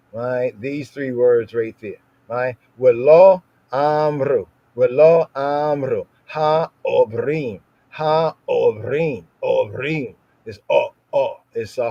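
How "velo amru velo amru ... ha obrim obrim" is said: "ha obrim" drawn out slowly with a guttural start; reading Hebrew with hard A. Shown as a demonstration. Teacher reading the verse in Hebrew to show the hard A, slow and deliberate. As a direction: read "Velo amru, ha obrim" slowly, stressing the hard A. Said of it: "the hard A".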